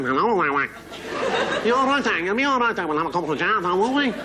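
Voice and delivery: exaggerated Scouse, all in the nose; nasal Liverpool accent. Comic imitates people in Liverpool, nasal Scouse accent. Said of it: "it's all in the nose".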